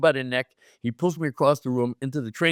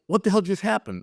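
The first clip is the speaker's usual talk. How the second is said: higher-pitched, bewildered question; retold stunned disbelief; 7 semitones higher than usual. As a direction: act out being dumbfounded afterwards. Stunned alone after meeting idols.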